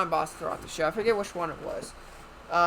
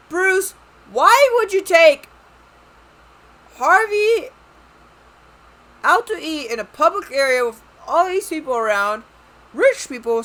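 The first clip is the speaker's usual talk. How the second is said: exasperated rant; 7 semitones higher, 8 dB louder than usual. Real, not acted. Vlogger ranting at Bruce for taking Harvey out, outraged. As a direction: let the outrage burst out over the top.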